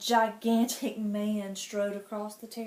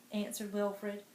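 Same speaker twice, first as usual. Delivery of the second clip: plain reading voice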